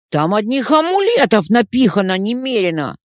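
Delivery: loud, indignant protest; indignant outburst. A narrator's reading. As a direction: protest loudly, offended. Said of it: "возмутился Адис".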